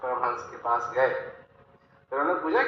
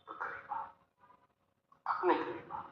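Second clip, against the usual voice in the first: hushed invitation; 14 dB quieter than usual. Acted. Inviting listeners to come closer.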